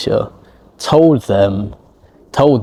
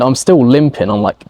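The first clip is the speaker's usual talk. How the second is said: usual speaking voice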